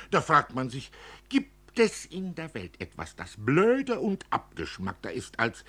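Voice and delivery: höher und lauter, bitter spottender Vater; über den sinnlosen Krieg ärgern; bitterer Vater. Acted. (verbitterter Vater, spöttisch)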